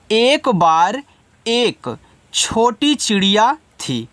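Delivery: slow, with pauses between phrases; word-by-word translation. Shown as a demonstration. Teacher assembles the meaning for students, slow and piece by piece. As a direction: build the sentence piece by piece, slowly. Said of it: "तो अर्थ कैसे आप इनका बनाओगे".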